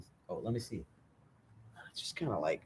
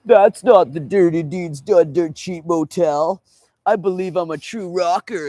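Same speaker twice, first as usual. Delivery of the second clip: higher, louder, slow dopey voice; mock know-it-all rocker; 5 semitones higher, 6 dB louder, slower than usual. Mocking fans at the wrong motel, dopey voice. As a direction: play the clueless fan who thinks he knows.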